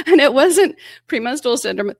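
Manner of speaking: high, loud, laughing through the line; giggling while speaking. Real, not acted. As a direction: laugh out the punchline. Laughing at her own joke.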